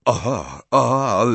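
halting, taken-aback gulp; stammered. A narrator's reading. Harry takes in the engagement news, stammering. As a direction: stumble out two dazed acknowledgements. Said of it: "wyjąknął Harry".

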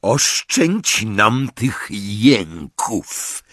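slow, menacing growl. A narrator's reading. Yaxley silences the sobbing accused, slow growl. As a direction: drag it out with snarling contempt. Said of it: "warknął Yaxley".

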